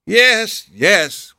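sharp, high, loud impatient yes yes; impatient cry. Acted. Duke cuts off Holmes, impatient high loud cry. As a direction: cut him off, fed up. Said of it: "cried the Duke impatiently".

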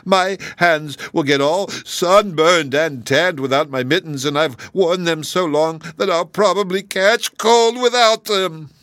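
high, whiny complaint rising to a wail; reproachful. A narrator's reading. Kangaroo frets to Dorothy about bare hands, reproachful.

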